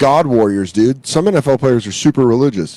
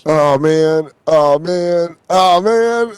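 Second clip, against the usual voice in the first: hammed-up lament getting higher; mock whining; 5 semitones higher than usual. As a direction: play up a pitiful wail. Acting out a miserable drinker's wail.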